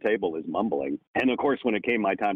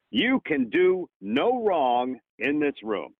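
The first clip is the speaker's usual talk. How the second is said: slow, higher, gently encouraging line; reassuring director; 6 semitones higher, much slower than usual. Retold or imitated. Director reassures the nervous auditioning actor, slow and kind. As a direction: give the nervous actor full permission. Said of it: "he goes".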